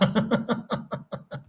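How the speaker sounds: high, soft trailing laughter; embarrassed giggle. Real, not acted. Streamer after repeating mock British farewell, giggling. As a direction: laugh breathily at your own silly accent.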